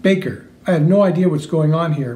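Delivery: usual speaking voice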